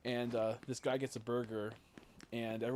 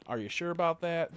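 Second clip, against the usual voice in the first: loud, high, hammed-up questioning; pitched-up catchphrase, said twice; imitating meme guy; 6 semitones higher, 9 dB louder than usual. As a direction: challenge loudly in a put-on high voice. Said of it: "weird-looking guy who's like".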